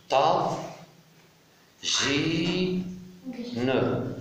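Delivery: "ta, ji, ne" as isolated syllables, long pauses between; split into syllables. Shown as a demonstration. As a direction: sound out "ta, ji, ne" one by one. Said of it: "je découpe le mot". (« ta, ji, ne » syllabe par syllabe, bien séparées)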